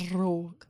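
"Brogue" with a long, softly trilled R; rolled R. Shown as a demonstration. Comparing how each says the word, "Brogue" with heavily rolled R. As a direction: roll the R right out in "Brogue". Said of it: "wouldn't roll my R's quite so much".

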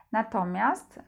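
ordinary talk, nothing marked

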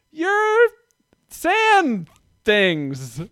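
put-on high, slow child naming the sandals; toddler voice. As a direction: say it like a three-year-old who lacks the word. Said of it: "she said it like that".

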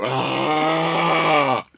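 mock groan of a rising corpse. Corpse sitting up to scare the rival, mock groan. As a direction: let out a sudden hollow "Ah!". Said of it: "causing the body to sit up ... and go".

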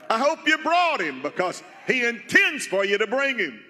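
high, rising preacher's exhortation; fervent preaching. Real, not acted. Pastor asking the congregation if they brought Jesus, fervent preaching. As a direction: press the congregation with pastoral fire.

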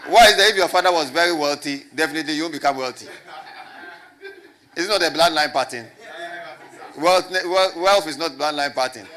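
loud, laughing mockery of a wrong belief; laughing preaching. Real, not acted. Preacher laughing at the congregation's belief, loud and amused. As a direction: laugh it off loudly as you preach.

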